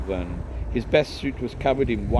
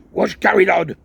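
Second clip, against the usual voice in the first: louder, higher barking question; angry demand; 9 semitones higher, 10 dB louder than usual. Acted. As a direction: demand it furiously. Angry uncle demanding answers.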